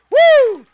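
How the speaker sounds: high, loud exultant "woo"; joyful whoop. Real, not acted. Preacher whooping with joy at his own line, loud whoop. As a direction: let out a happy whoop.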